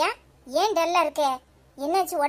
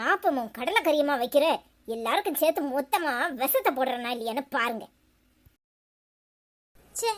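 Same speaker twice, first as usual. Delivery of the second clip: ordinary talk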